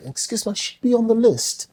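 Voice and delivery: high-pitched imitation British accent; mock British accent. Guest trying to get into a club, mock British accent. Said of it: "have you ever used it to get in a club".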